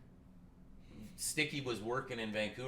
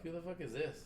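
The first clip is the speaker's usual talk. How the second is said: puzzled; 6 semitones higher, 5 dB quieter, faster than usual. Retold or imitated. Puzzled at unknown caller.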